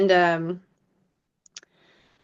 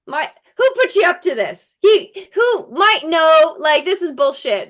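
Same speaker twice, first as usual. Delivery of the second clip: high, loud accusing disbelief; suspicious disbelief; 8 semitones higher, 6 dB louder than usual. Retold or imitated. A young actress suspecting her roommate's prank, loud and disbelieving. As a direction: demand who set this up.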